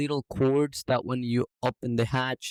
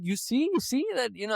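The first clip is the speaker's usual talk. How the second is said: justifying myself; 10 semitones higher than usual. Retold or imitated. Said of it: "I'm trying to justify myself".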